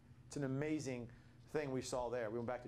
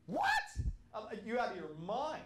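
shocked disbelief; 10 semitones higher, 6 dB louder than usual. Retold or imitated. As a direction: voice jumps much higher and louder in disbelief. Retold shocked reply to insult.

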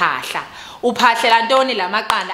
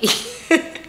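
breathy laugh; 8 semitones higher than usual. Real, not acted. Laughing at her own despair.

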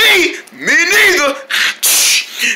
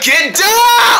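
higher, louder, slow yell of warning; urgent shout; 11 semitones higher, 3 dB louder than usual. Acted. Friend yells at Cunny to get down, a slow urgent shout. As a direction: bellow the warning so they drop.

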